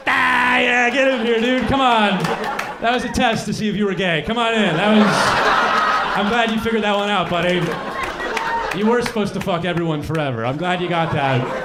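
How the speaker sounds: laughing, booming buddy God; bro God. Retold or imitated. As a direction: slap him on the back like a frat buddy.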